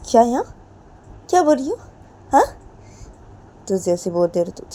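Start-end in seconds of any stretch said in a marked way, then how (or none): 0.1-2.4 higher and louder; angry questioning. Acted. Ritu snapping at Jiya, higher and louder. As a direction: demand an answer.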